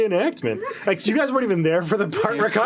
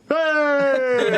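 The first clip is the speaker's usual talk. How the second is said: sailors cheering; 8 semitones higher than usual. Acted. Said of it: "All these sailors go".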